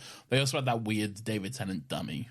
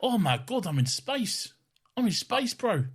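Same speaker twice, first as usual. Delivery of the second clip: a higher, laid-back New Zealand accent for the space line; 11 semitones higher than usual. Shown as a demonstration. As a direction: show how a real New Zealand accent sounds, ending on bro. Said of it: "if I was doing a New Zealand accent".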